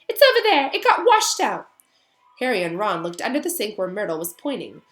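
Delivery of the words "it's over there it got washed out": put-on high, loud wailing girl ghost; miserable ghost girl. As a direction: sulk and wail like a self-pitying ghost. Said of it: "glaring at them".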